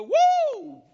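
sudden high whooping shout; joyful high-pitched woo; loud church whoop. Retold or imitated. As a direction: let out a big hallelujah whoop. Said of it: "I didn't go".

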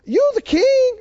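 high whimpering mock protest; hammed-up whining underling; whiny staff. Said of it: "And they said".

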